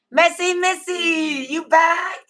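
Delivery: high, loud, slow call of a viewer's name; delighted high call-out; excited greeting. Real, not acted. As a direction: sing out a friend's name as she joins the stream. Greets viewer arriving on live.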